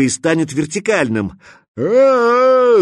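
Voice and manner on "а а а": long high loud scream of panic; terrified scream. Acted. Stalker screams as the bridge tips, terrified. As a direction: scream as if falling. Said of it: "закричал скворец".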